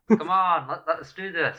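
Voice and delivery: loud eager call; shouting. Acted. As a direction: shout it across the distance. Calling out to distant ally.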